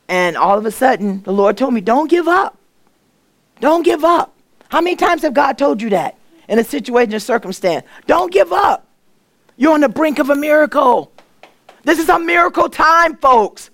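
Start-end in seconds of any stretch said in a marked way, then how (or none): 1.8-4.3 voice of the Lord. Retold or imitated. The Lord speaking to her. 8.1-13.7 preaching at full force. Acted. Preacher telling the congregation God's message, shouting.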